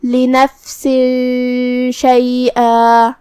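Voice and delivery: لنفس شیئا with the tanween hidden and a nasal hum into the شین; slow recited demo of a hidden nasal; ikhfa with ghunna. Shown as a demonstration. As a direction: hide the noon sound before شیئا, humming it. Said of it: "یہ حکم کو ادا کریں گے".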